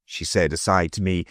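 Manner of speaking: ordinary delivery, nothing marked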